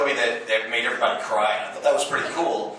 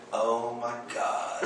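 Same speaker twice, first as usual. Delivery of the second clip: mock swoon; 2 semitones lower, 10 dB quieter, much slower than usual. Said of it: "she went".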